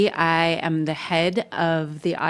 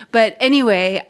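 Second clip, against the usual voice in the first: ordinary delivery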